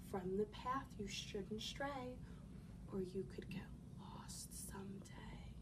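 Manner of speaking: hushed remembered warning; soft whisper. Acted. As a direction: whisper the rhyme softly. Mother's rhyme recalled, whispered.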